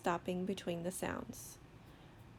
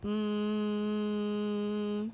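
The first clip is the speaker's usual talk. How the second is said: "Mm" as one long level hum; slow sound. Shown as a demonstration. Teacher models a sound for children, "Mm" held long. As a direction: hold "Mm" steadily without a break. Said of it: "say a sound slowly".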